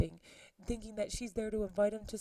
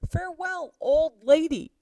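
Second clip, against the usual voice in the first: put-on Farewell jumped much higher, old lady much louder; louder, higher jeering goodbye to the nurse; bigger mock farewell; 8 semitones higher, 11 dB louder than usual. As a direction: push the taunt further, sneering. Mercutio mocking farewell.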